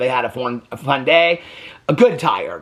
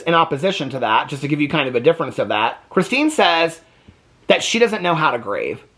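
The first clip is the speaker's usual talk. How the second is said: ordinary talk, nothing marked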